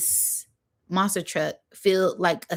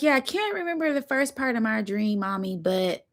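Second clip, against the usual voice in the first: put-on higher, light little boy voice; little son; 4 semitones higher than usual. Son telling Mommy about his dream, a light child voice. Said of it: "He's like".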